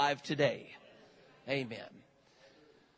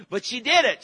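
higher and much louder, a triumphant declaration; excited burst; 9 semitones higher, 12 dB louder than usual. Real, not acted. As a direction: proclaim it like a victory. Triumphant turn in the healing story.

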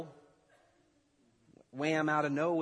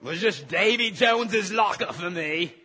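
loud, higher bad pirate voice; horrible pirate accent; 6 semitones higher, 10 dB louder than usual. Preacher clowning as Josh in trouble, bad pirate accent. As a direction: ham up a terrible pirate accent. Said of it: "That was horrible pirate accent".

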